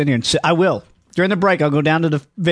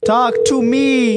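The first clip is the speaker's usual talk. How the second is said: slow, higher drawn-out line; movie quote; 7 semitones higher, much slower than usual. Retold or imitated. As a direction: stretch it out like the film.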